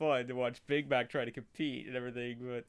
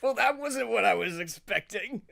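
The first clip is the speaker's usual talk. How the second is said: laughing; 6 semitones higher, 5 dB louder than usual. Real, not acted. Still laughing after the scene.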